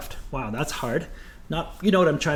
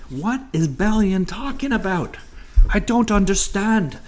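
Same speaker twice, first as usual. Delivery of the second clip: higher-pitched voice of a baffled student; mock confused student; 5 semitones higher than usual. Teacher voicing the class's confusion at the instructions, mock bewildered. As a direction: play a lost student, pitched up.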